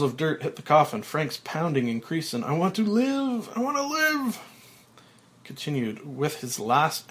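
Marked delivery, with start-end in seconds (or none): 2.4-4.4 high, loud desperate cry; desperate shouting. Acted. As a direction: scream to stay alive. Buried alive, pounding coffin.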